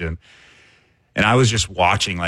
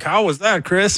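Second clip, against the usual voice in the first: mock co-host asking, pitched much higher; lightly voiced, higher co-host query; playful higher take on the co-host; imitating Ben's question; 11 semitones higher than usual. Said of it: "Ben's like".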